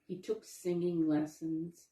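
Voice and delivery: usual speaking voice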